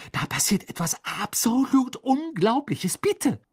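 high, loud excited pleading; bubbling over, begging; breathless excitement. Acted. Hermine pleading with Harry, high excited begging.

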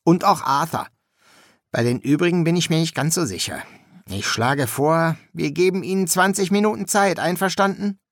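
upbeat, easygoing suggestion; breezy student Shelter. A narrator's reading. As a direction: propose it lightly, lifting into the question.

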